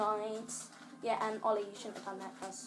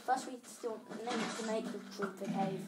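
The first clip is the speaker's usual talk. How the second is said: ordinary talk, nothing marked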